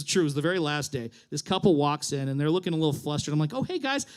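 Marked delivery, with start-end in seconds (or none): 3.5-4.1 higher-pitched customer-service hello; put-on friendliness, dead inside; forced smiley greeting. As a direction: greet them with a pasted-on smile. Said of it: "And I'm smiling, but you can tell".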